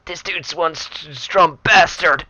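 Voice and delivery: strained and slow, as if pushing hard; dragged out through effort; straining. Acted. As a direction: force the words out under strain. Straining against a strong boss.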